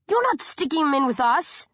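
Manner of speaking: furious shouted refusal. A narrator's reading. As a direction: shout him down in outrage. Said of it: "I shout".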